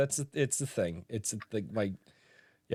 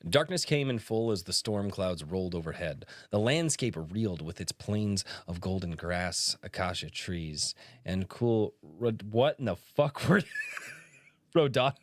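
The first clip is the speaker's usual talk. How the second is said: lower, measured storytelling read; dramatic reading; 4 semitones lower than usual. Acted. Reading the author's first page aloud as a dramatic narrator. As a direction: read it like an epic opening.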